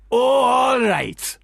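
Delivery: put-on drawn-out Pakistani accent, high and loud. Retelling [name] giving in, in a Pakistani accent. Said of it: "Pakistani accent".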